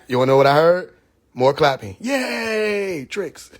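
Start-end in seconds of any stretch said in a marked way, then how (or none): 2.0-3.5 mock cheering audience. Audience clapping, mocked.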